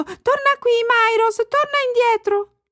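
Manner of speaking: very high-pitched calling cry; child yelling. A narrator's reading. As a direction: call the runaway cow back urgently. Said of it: "le corse dietro urlando". (bambina che urla con voce acutissima)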